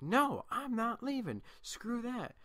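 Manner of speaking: high, loud, slow furious refusal; flipping out. Retold or imitated. Girl flipping out when the teacher guides her to the door. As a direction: blow up and spit the words out. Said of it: "she just flips out on her".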